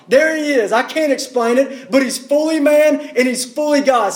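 fervent preacher, punching key phrases; impassioned preaching. Real, not acted. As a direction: burst out with "No!" and keep preaching the good news with force. Breaks into forceful proclamation of good news.

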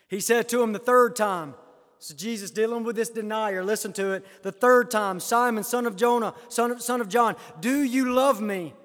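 preacher declaiming scripture with force; emphatic preaching. Acted. Preaches the third asking.

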